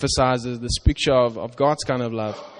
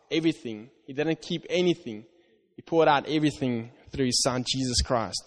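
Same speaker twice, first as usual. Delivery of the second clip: ordinary talk, nothing marked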